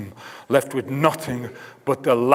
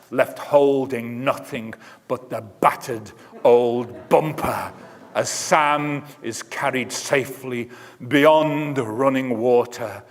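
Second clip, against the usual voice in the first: ordinary delivery, nothing marked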